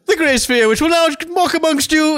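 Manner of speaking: high, grandiose ceremonial proclamation; mock solemn announcer. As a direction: announce it like a sacred rite. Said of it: "ridiculous, like, it is, like, some satanic ritual".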